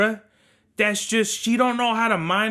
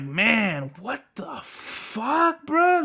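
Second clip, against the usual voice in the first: stunned disbelief; 3 semitones higher, much slower than usual. Retold or imitated. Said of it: "So I'm like".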